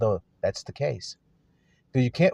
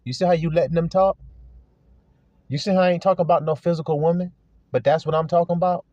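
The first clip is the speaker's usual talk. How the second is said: ordinary talk, nothing marked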